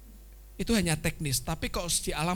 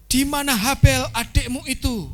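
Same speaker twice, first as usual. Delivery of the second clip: a lightly raised, louder God asking; 7 semitones higher, 7 dB louder than usual. Acted. Preacher voicing God asking Cain, a little higher and louder. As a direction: call out the question as God. God's question in the story.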